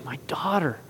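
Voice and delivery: softly spoken, gentle reassurance; tender, affectionate. Retold or imitated. Jesus speaks to the healed woman, tender and affectionate. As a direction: say it softly and tenderly, letting the end linger. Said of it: "in such a tender and affectionate way".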